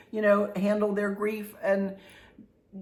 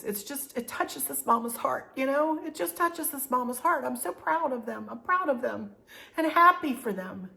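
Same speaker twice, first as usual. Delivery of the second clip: choked up; 5 semitones higher than usual. Real, not acted. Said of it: "these emotions are still right here".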